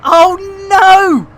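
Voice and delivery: high, loud mock panic; panicked cry. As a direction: yelp it in alarm. Alarmed outburst.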